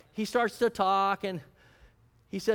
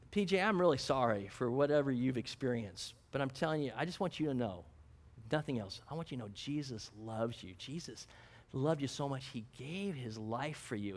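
tender plea; 5 semitones lower, 7 dB quieter than usual. Retold or imitated. Pastor telling man Jesus loves him.

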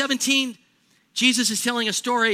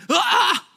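a high, loud mock cry of envy; mock anguished cry; 11 semitones higher, 7 dB louder than usual. Preacher mimicking a tourist's jealous anguish with a loud cry. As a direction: let out a loud envious yelp. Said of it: "envious outburst".

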